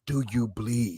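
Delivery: low, quiet, serious menace; Batman. Retold or imitated. Fan quotes Batman's line to Superman, serious low threat. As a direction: drop low and soft, deadly serious. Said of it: "That was a serious line".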